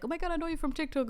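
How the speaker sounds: fast, high, gushing recognition; a fan spotting a creator, rattled off quickly; excited fan. Retold or imitated. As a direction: stranger in London recognizing her, excitedly. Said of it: "they were like".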